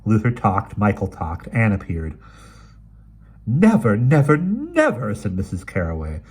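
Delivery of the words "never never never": outraged repetition. Acted. Outraged old lady at servant.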